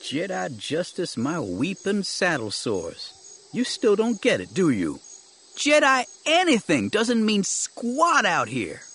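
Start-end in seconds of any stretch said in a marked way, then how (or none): 3.5-8.8 high, stretched-out exasperation; exasperated scoffing. Acted. Companion scoffing at Jedi justice, exasperated.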